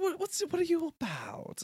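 very high, theatrical questioning; grand mock host, sliding down from high pitch; showy interviewer. Host grilling the new fusion, high and theatrical.

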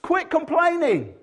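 high, loud indignant telling-off; mock angry God. Preacher as God, scolding cheeky Abraham, loud and high. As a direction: scold him like an outraged parent. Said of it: "And I'd be arguing".

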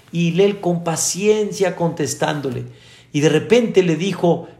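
usual speaking voice

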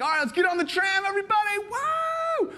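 high whooping cheer; overexcited cheer. Retold or imitated. Visitor rallying the group onto the tram, whooping high. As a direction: treat the tram like a roller coaster.

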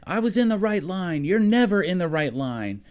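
arguing wife. Retold or imitated. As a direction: play a wife snapping back at her husband. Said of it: "starts arguing with her".

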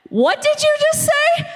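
high-pitched, rising mock disbelief; retold incredulity. Coworker reacting to her mispronounced phrase, mock incredulous.

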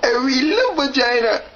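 high-pitched, hammed-up crying; tearful. Dater breaking down on his dating video, tearful. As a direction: break into mock sobs while apologizing. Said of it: "I'm getting emotional".

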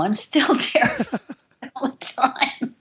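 real laugh through the confession. Real, not acted. Answering the interviewer, laughing high.

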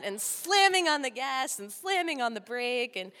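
normal talking voice

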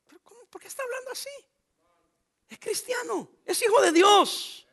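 voz mucho más aguda, incrédula, casi sin aire; asombro incrédulo. Pretend. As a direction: finge escandalizarse por el chamaco. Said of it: "y mira que". (el pastor imita su propia reacción al ver el video, escandalizado)

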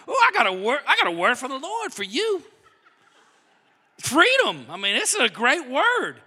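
eager, bursting with good news; excited Moses. Retold or imitated. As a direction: announce it like you can't wait to tell them. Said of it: "He's all excited".

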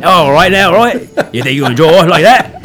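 lightly voiced tough-guy movie villain; a hint of an action-movie heavy; Tango and Cash actor. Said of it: "talk like fucking Tango and Cash".

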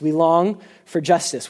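usual speaking voice

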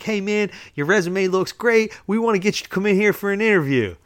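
high, eager, upbeat pitch; enthusiastic boss. Retold or imitated. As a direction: imitate a super keen guy calling with good news. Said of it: "He's very enthusiastic".

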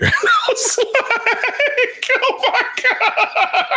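very high, slow, laughing cry; laughing disbelief. Real, not acted. Host reacting to kid's party story, laughing in disbelief. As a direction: overcome by laughter at the news. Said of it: "reacting to kid's story".

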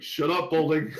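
retold snap. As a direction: bark it back at your friend. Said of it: "and he's like".

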